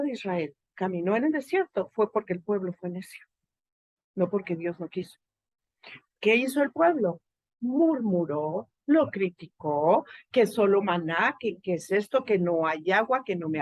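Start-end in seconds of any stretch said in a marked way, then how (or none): none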